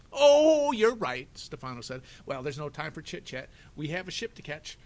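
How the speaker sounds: a villain pretending to give in; mock agreement. Stefano mockingly agreeing with Violet, high and loud.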